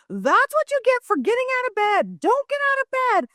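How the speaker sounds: high-pitched mock yell, no real volume; retold hollering. Mom hollers at son after pepper spray, high mock yell. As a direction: act out an exasperated mom hollering. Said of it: "So he and I are both hollering".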